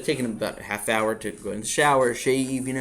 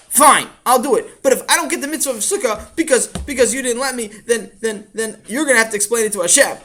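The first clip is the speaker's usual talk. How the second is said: put-on high, louder grudging child voice; sulky child; 9 semitones higher, 8 dB louder than usual. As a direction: grumble it like a pouting kid. Sulky child threatens father.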